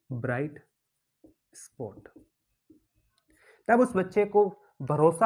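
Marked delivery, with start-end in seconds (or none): 0.1-2.1 hushed reveal. Shown as a demonstration. Teacher softly reveals bright spot to the students. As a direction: lower and softer, the last word almost whispered.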